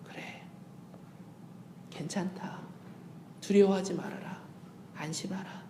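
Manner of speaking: near-whispered, tender comfort; hushed reassurance. Acted. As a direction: soothe a crying child gently. God comforting child softly. (아주 작게 다독이듯)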